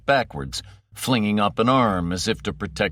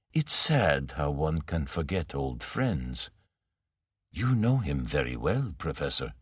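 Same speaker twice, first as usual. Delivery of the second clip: Morrow, soft menace; 5 semitones lower, 6 dB quieter than usual. Acted. Villain Morrow needling the professor, low and soft. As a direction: purr it softly like a patient villain.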